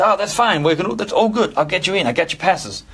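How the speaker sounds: high, easygoing put-on American accent. Drummer promising backstage passes for the mates, in an American accent. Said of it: "That's my American accent".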